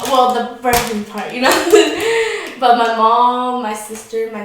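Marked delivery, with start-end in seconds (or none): 1.6-2.6 high, loud giggle; real laugh. Real, not acted. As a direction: burst of laughter after a joke. Laughs at own joke.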